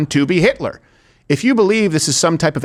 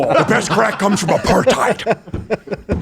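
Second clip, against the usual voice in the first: slow, higher put-on voice with laughter; mock dealer; 6 semitones higher, much slower than usual. Host playing a sleazy crack dealer, slow and laughing.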